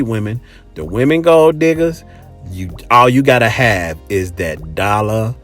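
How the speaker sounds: ordinary talk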